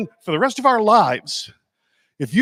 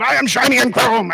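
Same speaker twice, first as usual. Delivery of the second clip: high, loud war cry of kids playing Mad Max; kids as Mad Max war boys; 7 semitones higher, 4 dB louder than usual. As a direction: yell it like a wild kid on the field. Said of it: "eleven-year-olds running around the field".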